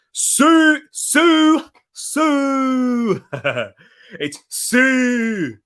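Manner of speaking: put-on high-pitched call of Sue in a Scottish accent. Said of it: "It's like saying Sue in a Scottish accent".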